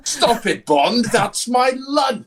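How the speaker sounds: very high, drawn-out mock protest; hammed-up exasperated character voice; Q scolding Bond. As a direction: whine loudly at Bond, slowing down.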